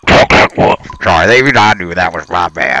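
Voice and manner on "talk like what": garbled, voiceless burst into the mic; muffled mic talk. Pretend. Co-host playing innocent when told off, muffled and garbled. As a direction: smother the words on the mic. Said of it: "why you wanna talk like that".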